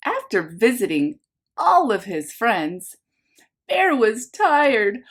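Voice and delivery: lively picture-book narration for a class; reading to children. A narrator's reading. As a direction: read it like story time for little ones. Teacher reads picture book aloud.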